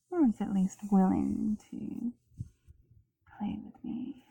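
muffled whisper behind a mask; through a gas mask. Real, not acted. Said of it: "Does my voice sound much different now?".

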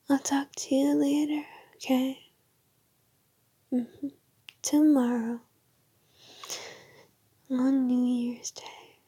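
drowsy goodnight whisper; sleepy whisper. Acted.

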